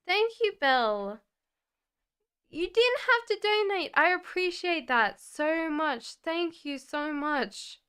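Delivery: high, loud, choked-up thanks; thanking while fighting off tears; emotional gratitude on the verge of crying; holding back tears. Real, not acted. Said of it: "I am not crying".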